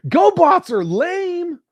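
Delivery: high slow teasing jab; mock taunt.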